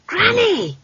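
put-on high-pitched girl calling out; sudden high child's call; alarmed child's cry. A narrator's reading. Child calls out as broomstick dives.

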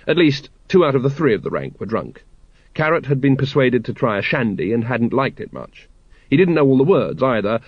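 usual speaking voice

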